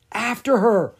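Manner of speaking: high, loud urgent whisper; a pressed whisper pushed out loud. A narrator's reading. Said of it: "Lucas said in a loud whisper".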